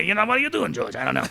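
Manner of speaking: mock-cranky old man persona; cranky old critic. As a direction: gripe like a fed-up old critic. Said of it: "When I need to be cranky with George Lucas".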